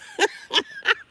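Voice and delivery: high, loud cackle; helpless high laugh; burst of laughter. Real, not acted.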